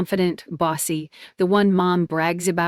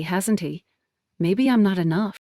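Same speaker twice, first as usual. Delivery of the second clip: usual speaking voice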